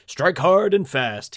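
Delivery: pompous governor. Acted. The governor rallies the doubtful council, booming and pompous. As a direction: puff yourself up and command the hall. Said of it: "pompous as he is".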